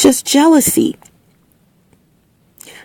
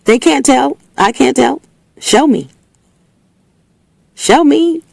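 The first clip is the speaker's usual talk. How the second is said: high, daring taunt; challenging; 7 semitones higher than usual. Real, not acted. Daring the doubters to show her, high and taunting. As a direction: dare them to show you.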